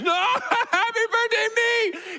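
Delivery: put-on very high, loud toddler squeal of protest; a father laughing through his son's meltdown wail; imitating wailing toddler. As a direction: shriek it like a two-year-old losing the cookie. Toddler protests cookie cutting.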